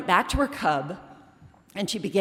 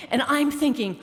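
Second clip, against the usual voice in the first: ordinary talk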